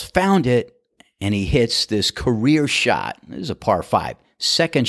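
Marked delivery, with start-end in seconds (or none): 0.0-0.7 high-pitched mock yell; yelled quote at moderate volume; retold yell. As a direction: call it out high, imitating a yell. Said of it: "Clyde yells".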